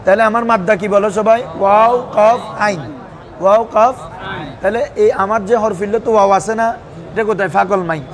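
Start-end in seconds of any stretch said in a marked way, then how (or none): 1.6-4.0 slow, letter by letter; slow spelling-out. Shown as a demonstration. Teacher leading students through the root letters, slowly spelled out. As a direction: stretch out each Arabic letter name for the class.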